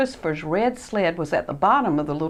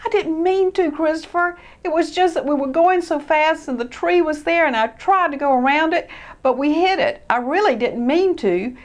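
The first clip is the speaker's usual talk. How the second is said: higher, louder miserable pleading; moaning apology; 9 semitones higher, 3 dB louder than usual. Acted. Ted moaning his apology to Christopher. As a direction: moan it out ashamed. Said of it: "Ted moaned".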